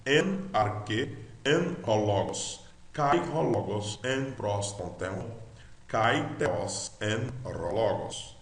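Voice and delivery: slow, measured reading aloud of Greek from "en arche" to "en hologos"; careful teacher's Greek, each word of "kai theos" set apart; demonstrated Greek reading. Shown as a demonstration. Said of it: "Ele seria lido assim".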